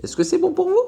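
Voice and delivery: high squeaky voice. Pretend. Squeaky put-on voice aside.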